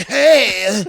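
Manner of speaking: loud, high mock-goth greeting; Chris Angel. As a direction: imitate a goth illusionist calling out. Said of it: "like, goth".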